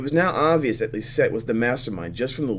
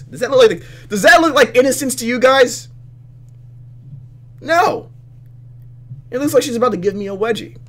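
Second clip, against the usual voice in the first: high, loud indignant questioning; loud disbelief; 14 semitones higher, 10 dB louder than usual. Real, not acted. Player asking viewers about the rival's innocent look, indignant.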